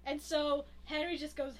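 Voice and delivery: ordinary talk, nothing marked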